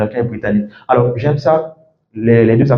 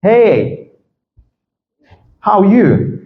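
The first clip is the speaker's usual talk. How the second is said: "Hey, how are you?" higher, rising then falling; melodic intonation; 6 semitones higher, much slower than usual. Shown as a demonstration. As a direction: swoop up and down on "Hey" and "you", pausing between. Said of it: "L'intonation vient arranger ça".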